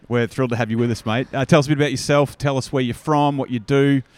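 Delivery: bright energetic podcast host greeting a guest; interview host intro. Acted. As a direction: open the interview like an on-air host. Host opens recorded interview.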